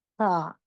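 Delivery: सा soft, tongue tip on inner edge of teeth. Shown as a demonstration. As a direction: say सा lightly without whistle. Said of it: "softly हम इसको पढ़ेंगे, like". (नरम सा)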